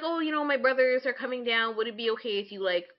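ordinary talk, nothing marked

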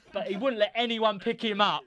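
ordinary talk

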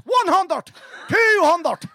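high, punched Cavan mart call; Cavan accent. Retold or imitated. Reprising the singer's line for friends, loud Cavan accent. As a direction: shout it in thick Cavan. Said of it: "in a Cavan accent".

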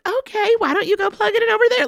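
mock saccharine sweetness. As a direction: imitate a relentlessly sweet heroine. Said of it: "stop being so saccharine".

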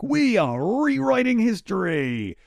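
high, slow, put-on pompous declaration; mock-grand voice of a critic; mock grand proclamation. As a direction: ham up a lofty claim. Mocking critics of historical musicals.

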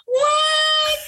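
put-on indignant little girl, crying out; child's wail. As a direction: whine it like a seven-year-old who's had enough. Daughter protesting the extra quiz.

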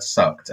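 "sucked" low and falling, leaned on; emphasis on sucked. Retold or imitated. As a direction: drop low and lean on "sucked". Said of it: "putting emphasis on the word".

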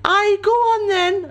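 very high, slow put-on woman; woman's voice. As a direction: squeak it out as a woman. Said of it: "a voice replied".

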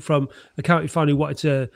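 ordinary talk

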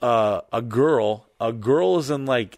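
ordinary talk

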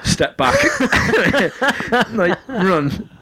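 laughing through the words. Real, not acted. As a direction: crack up as you say it. Laughing while telling barge.

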